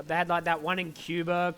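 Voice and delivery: ordinary talk, nothing marked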